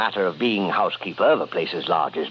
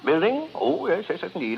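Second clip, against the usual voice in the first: caught off guard; 9 semitones higher than usual. Acted. Caught out, nervously eager reply.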